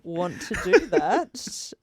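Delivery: slow refusal through laughter; laughing refusal. Retold or imitated. Friends answering the sister's remark, laughing refusal. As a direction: laugh while drawing out the retold objection.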